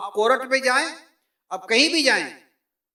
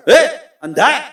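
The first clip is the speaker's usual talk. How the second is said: loud angry yell at a stranger; angry shout; 2 semitones higher, 10 dB louder than usual. Acted. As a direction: bark it like a shoved man. Acted street quarrel shove.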